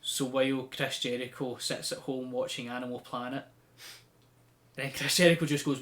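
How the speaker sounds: ordinary delivery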